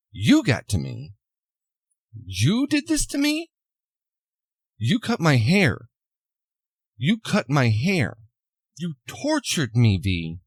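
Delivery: mock disbelief, pitch swooping up on key words; incredulous accusation. Woman confronts V over torturing her, incredulous accusation. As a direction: act out hurt accusations with pauses between.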